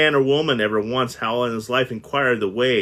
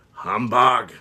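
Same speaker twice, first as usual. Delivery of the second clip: grumpy humbug. Acted. Scrooge brushing off Christmas with a sour humbug. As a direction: sneer it like an old miser. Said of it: "said Scrooge".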